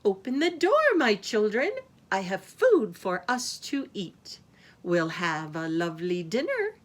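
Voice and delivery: sugary, sing-song imitation of mother; sweet coaxing voice. A narrator's reading. Wolf imitates Mama Goat for the kids, very sweet voice. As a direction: coax sweetly, starting high. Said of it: "in a very sweet voice".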